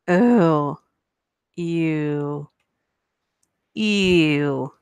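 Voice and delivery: drawn-out grossed-out ews; disgusted ew. Real, not acted. Reacting to a gross story with disgusted ews. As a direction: recoil in disgust, word by word.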